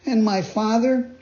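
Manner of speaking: high, anxious puppet voice; Pinocchio voice. Acted. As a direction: ask fearfully, high.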